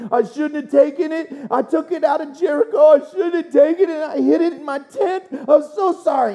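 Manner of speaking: hammed-up high, wailing apology; frantic confession. Achan confessing he hid the loot, mock frantic wail.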